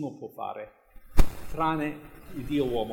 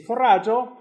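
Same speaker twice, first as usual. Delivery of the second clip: calling out; 10 semitones higher, 12 dB louder than usual. Acted. Jesus calls to disciples.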